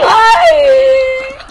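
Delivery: high yelping ouch; pained cry. Pretend. Kid yelps in pain while playing. Kid cries out in pretend play.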